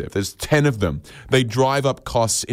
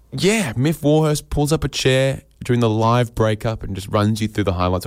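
ordinary talk

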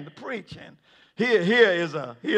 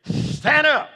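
court clerk's command; 8 semitones higher than usual. Acted. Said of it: "look at Peter and John and say".

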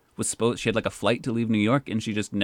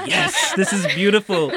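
loud, high, laughing cheer; a delighted bystander shouting with laughter; cheering crowd; 11 semitones higher, 8 dB louder than usual. Retold or imitated. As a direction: cheer it like an onlooker at a joyful wedding.

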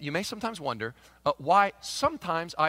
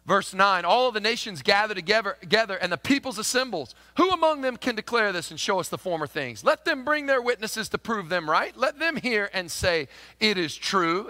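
raised, ringing scripture read; 6 semitones higher, 5 dB louder than usual. Acted. Pastor reads the verse aloud to the congregation, ringing. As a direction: proclaim the verse to the church.

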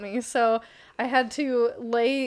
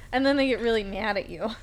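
normal talking voice

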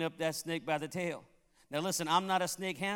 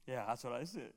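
quiet aside; 7 semitones lower, 10 dB quieter than usual. Real, not acted.

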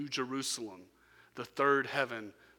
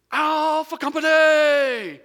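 huge, much higher and louder, slow drawn-out roll-call shout; Drill Sergeant Truss bellow; 18 dB louder, much slower than usual. Retold or imitated. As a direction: bellow it across the barracks. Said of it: "bellowed, it just echoed".

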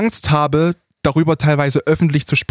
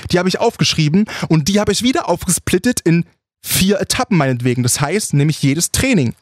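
ordinary talk

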